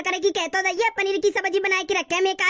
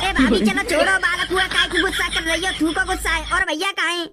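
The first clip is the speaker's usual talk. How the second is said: normal talking voice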